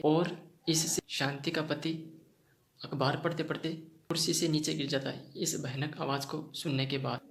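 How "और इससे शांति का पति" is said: plain reading voice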